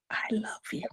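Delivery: whispered, barely voiced; tender hushed I love you; soft whisper. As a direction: breathe it gently like a remembered voice. Mother's voicemail sign-off recalled.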